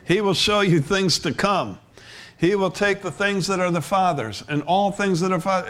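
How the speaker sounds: fervent pulpit appeal; preaching. Acted. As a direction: press the point home to the hearers.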